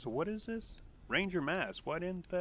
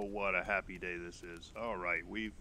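ordinary talk, nothing marked

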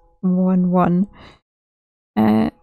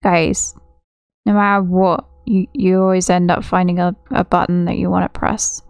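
normal talking voice

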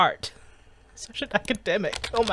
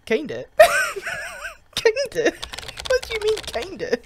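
outraged disbelief; 12 semitones higher than usual. Acted. Outraged at friend's "kinda.

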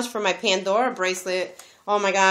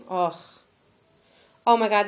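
ordinary delivery

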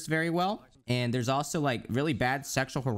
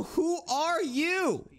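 much higher, very slow drawn-out mock sneer; snooty brand voice; much slower than usual. Wendy's account snubbing the streamer, slow mock sneer. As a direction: stretch "Who are you?" slowly in a snide put-on voice. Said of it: "They go".

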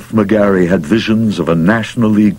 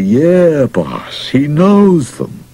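weary reassurance, high drawn-out start and slow finish; tired; 7 semitones higher, much slower than usual. A narrator's reading. Catcher answering his manager for the fourteenth time, tiredly. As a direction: drag it out, tired of being asked. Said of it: "he said tiredly".

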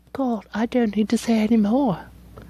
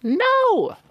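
squeaky no; 11 semitones higher than usual. Real, not acted. Camera misbehaving.

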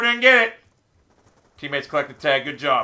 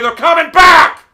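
upset shout; 6 semitones higher, 8 dB louder than usual. Real, not acted. Gamer losing his lead, upset shout. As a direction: voice jumps high and loud in alarm. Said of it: "I'm getting real upset now".

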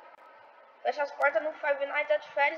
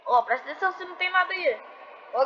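ordinary talk, nothing marked

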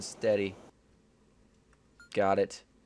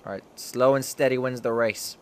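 ordinary talk

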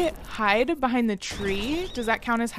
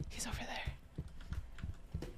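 whisper; 19 dB quieter, much slower than usual. Real, not acted. Secretly pointing out a seeker.

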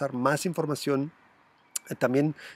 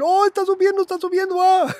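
much higher, hammed-up giddy greed; mock excited buyer; 7 dB louder than usual. Vlogger mocking naive buyers when a coin rises, high giddy mock voice. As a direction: squeal it like a naive buyer watching the price rise.

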